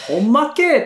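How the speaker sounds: quick Kansai-dialect ほんまけ？ rising high; Kansai-style ほんまけ？ with a lifted question. Shown as a demonstration. 実家の人の関西弁の言い方として関西弁で. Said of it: "とかじゃなくて".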